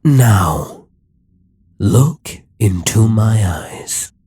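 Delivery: loud, slow command to look. Acted. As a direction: order the hero to stare into your eyes. Demon king hypnotizing the hero.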